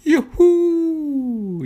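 high, loud whoop of delight; joyful cheer. A narrator's reading. Little drop cheers as he plunges to earth, a high loud whoop. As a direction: shout it for joy while falling. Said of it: "jubelte der kleine Wassertropfen begeistert".

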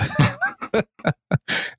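a high, squeaky burst of laughter; high squealing laugh. Real, not acted. Host cracks up at the recap. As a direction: let a high shriek of a laugh escape.

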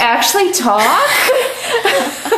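high, slow, laughing mock horror; high drawn-out giggling disbelief; mock horrified self. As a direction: squeal it out in disbelief at your own voice. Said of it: "And just be like".

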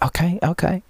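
thick mock Chinese accent, pitched high. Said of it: "Chinese accent".

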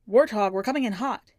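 boy playing soldier into a walkie; pretend radio call. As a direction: call it over the radio like a pilot. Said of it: "he said into the walkie".

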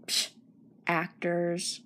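low, soft scoffing mumble; scoff, then sigh the word away; dismissive sigh. Shown as a demonstration. [name] showing listeners how to disagree, sighing dismissively. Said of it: "you can just sigh and say".

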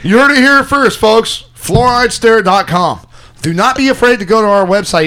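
loud, high hype-man announcement; big, high-energy promo shout; announcer plug. Pretend. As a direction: belt out the plug, slowing on the web address. Plugging the show to listeners.

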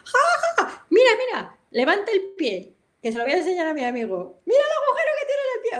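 high, laughing mock quote of the sensei; teacher laughing at her. Imitating the teacher mocking the hole in her foot, high laughing voice. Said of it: "hacía fotos y se reía".

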